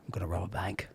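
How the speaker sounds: low hushed tough-guy whisper. Acted. Star plotting a bank job, whispered. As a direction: whisper it like a secret plan.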